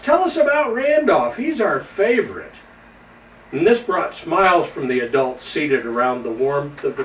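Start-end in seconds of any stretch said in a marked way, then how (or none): 0.0-2.5 high, eager pleading; eager children chiming in. A narrator's reading. Young reindeer pleading with the elders, lightly voiced eager chorus. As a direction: beg for the story like excited kids. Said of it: "would chime in the rest".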